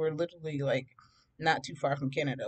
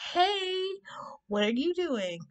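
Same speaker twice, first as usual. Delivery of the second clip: high, drawn-out flirty phone voice, acted; gushing greeting; 13 semitones higher, slower than usual.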